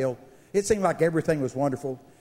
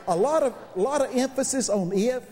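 usual speaking voice

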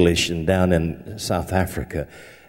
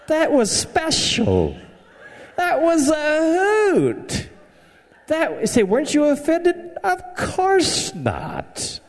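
high, slow squeaky aside; comic high squeak; slower than usual. Acted. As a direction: play it up in a silly high voice. Laughing high squeaky comments.

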